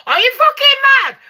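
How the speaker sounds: high, loud outraged disbelief; incredulous outburst. Acted. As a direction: shoot it up high, scandalised. Outraged at imagined bravery.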